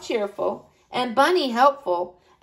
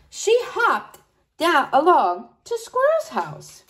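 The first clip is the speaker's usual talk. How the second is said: ordinary delivery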